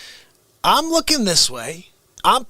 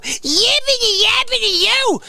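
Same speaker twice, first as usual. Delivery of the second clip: high, lightly put-on babble voice; mocking yap; 12 semitones higher than usual. Mocking an arguer's babble, high and silly. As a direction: yap it like a cartoon. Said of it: "You go".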